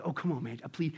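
soft, low pleading; acted begging. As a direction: beg gently under your voice. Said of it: "He's just begging".

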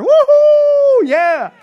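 high, loud cheering whoop; joyful whoop. Real, not acted. Pastor cheering about heaven, ecstatic whoop. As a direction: shout for joy at the good news. Celebrating future without sin.

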